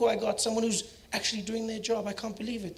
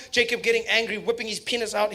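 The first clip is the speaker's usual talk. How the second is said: ordinary talk, nothing marked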